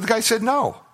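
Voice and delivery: put-on high, whiny-child style complaint; mock sulking. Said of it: "He went back and sulked".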